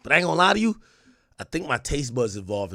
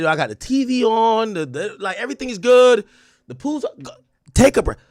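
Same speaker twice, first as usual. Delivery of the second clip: high, loud urging; pleading with mom; 9 semitones higher, 7 dB louder than usual. Retold or imitated. Son loudly urging his mom to take a break. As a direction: beg her loudly to rest. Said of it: "I said".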